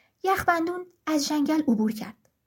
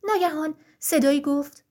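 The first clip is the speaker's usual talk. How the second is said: ordinary delivery, nothing marked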